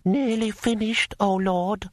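high, slow, deferential scribe; nervous servant. A narrator's reading. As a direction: placate your master in a high, careful voice. Licked his brush nervously.

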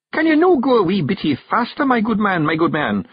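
high Scots plea for speed; bearded passenger, impatient. A narrator's reading. Passenger urging the carter to hurry, high Scots. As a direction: press the driver in high Scottish brogue.

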